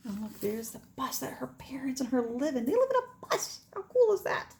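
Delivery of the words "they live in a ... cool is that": high, bright amazement at the bus; excited. Real, not acted. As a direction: sound thrilled to share it. Excited aside to children.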